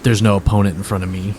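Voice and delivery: low, quiet put-down; cold contempt. Acted. Bard belittling his wounded opponent, coldly contemptuous. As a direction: drop the voice and dismiss them coldly.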